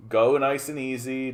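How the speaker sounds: ordinary talk, nothing marked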